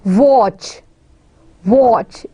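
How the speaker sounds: watch in one single puff of air; one-syllable demo. Shown as a demonstration. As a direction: say watch as one isolated falling beat, then repeat. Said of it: "pronounced with the one single breath".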